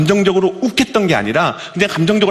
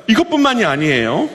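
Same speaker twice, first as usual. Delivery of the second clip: ordinary delivery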